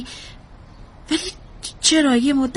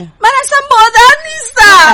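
high, very loud cry of denial; anguished shout; 9 semitones higher, 12 dB louder than usual. Acted. Mother rejects motherhood before therapist, shouting in anguish. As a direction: scream it out in desperation. Breaks down denying she is mother.

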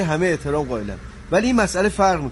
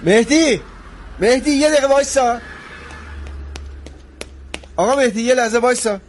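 raised, urgent call to wait; calling after someone; 4 semitones higher than usual. Acted. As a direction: call out to stop him leaving. Calling after departing friend.

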